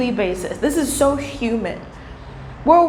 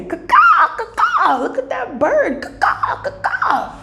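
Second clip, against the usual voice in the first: high squawky caw-caw bird call; mock crow caw; 11 semitones higher, slower than usual.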